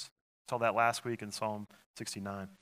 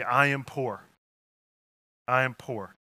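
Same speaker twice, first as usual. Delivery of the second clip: loud confession; 3 semitones higher, 9 dB louder than usual. Shown as a demonstration. Congregation voicing their need aloud.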